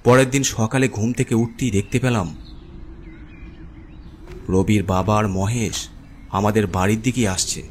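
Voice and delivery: ordinary delivery, nothing marked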